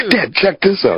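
put-on high, eager little kid showing off a song. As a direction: imitate an excited young son. Son showing dad a song.

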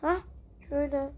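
ordinary talk

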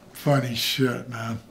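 ordinary talk, nothing marked

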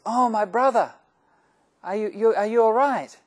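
high, loud cry of brotherly alarm; anxious, effusive concern. Acted. Priest to rabbi after the car crash, high effusive concern. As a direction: call out, overcome with concern.

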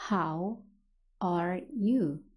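slow, separated words with pauses. Shown as a demonstration. English teacher models a greeting for learners, word by word. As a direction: say it slowly, one word at a time.

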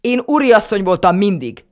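haughty grandma screeching her claim; shrill indignation. A narrator's reading. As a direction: shriek it out proudly. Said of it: "visított Nusi nagyi".

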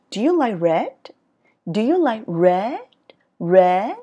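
slow "Do you like red", "red" swooping up; rising question tune. Shown as a demonstration. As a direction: stretch "red" upward. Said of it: "ngữ điệu ở cuối câu nó đi lên". ("red" lên giọng, kéo dài)

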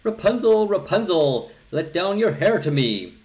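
very high, loud call up to the tower. A narrator's reading. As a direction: shout up eagerly to the window. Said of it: "when the king's son came and cried".

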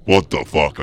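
loud shocked exclamation; loud outburst. Real, not acted. Gamer yelling as he lands in water, loud shock. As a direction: blurt it out in shock.

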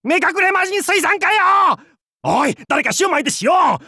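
high, frantic shouted retort; panicked comic yell. Acted. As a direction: cry out for help in mock alarm.

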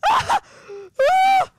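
high piercing laughing shriek; shrill ah of panic; panicked scream. Pretend. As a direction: let out a shrill yelp of alarm. Panic peak at the fire.

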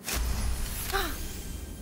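high sharp gasp. Real, not acted. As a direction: gasp in sudden delight. Success on dice roll.

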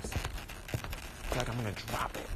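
slow hushed whisper. Real, not acted. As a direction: whisper it to yourself. Hushed aside.

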